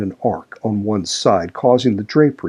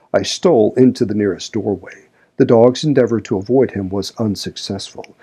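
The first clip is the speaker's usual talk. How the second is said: usual speaking voice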